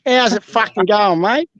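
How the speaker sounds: broad Aussie "How's it fucking going, mate?" with rising "mate"; real Australian accent demo. Shown as a demonstration. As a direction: correct Aussie take after the English one. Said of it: "No, that's English".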